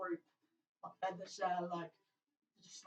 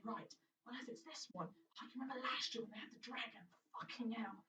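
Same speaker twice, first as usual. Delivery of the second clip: quiet muttering fighter; 3 semitones higher, 7 dB quieter than usual. Acted.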